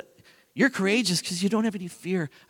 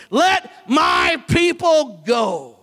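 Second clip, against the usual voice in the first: slow, loud, higher proclamation; commanding shout; 4 semitones higher, 3 dB louder, much slower than usual. Acted. Moses demanding Pharaoh free his people, slow commanding shout. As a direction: thunder it out like a prophet. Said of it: "He said".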